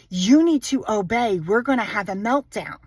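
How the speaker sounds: ordinary delivery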